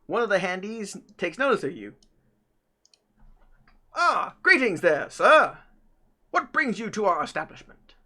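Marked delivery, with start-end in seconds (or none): 3.9-7.9 polite British host, pitched up; British robot butler. Acted. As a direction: greet them in a lofty British accent. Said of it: "have a British accent most of the time".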